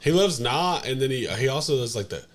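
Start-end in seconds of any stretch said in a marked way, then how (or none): none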